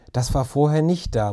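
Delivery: ordinary delivery, nothing marked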